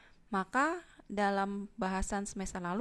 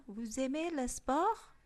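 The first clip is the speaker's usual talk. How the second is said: "Vous aimez le sport?" slowly, higher, rising at the end on "sport"; slow rising question tune; 5 semitones higher, 3 dB louder, slower than usual. Shown as a demonstration. Teacher repeats the question slowly, rising on "sport". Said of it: "tinggal dinaikkan".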